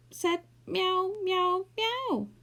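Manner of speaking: high mewing, slow; drawn-out cat meows; meowing. A narrator's reading. Saucepan showing the cat how he meowed.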